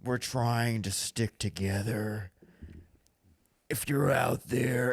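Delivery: very slow, mock-solemn radio monologue; Rick. Host mocking Rick on the walkie-talkie, slow solemn monologue. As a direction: drag out each word like a weary broadcast. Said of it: "so he can monologue".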